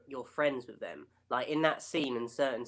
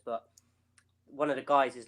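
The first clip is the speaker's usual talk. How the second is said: ordinary delivery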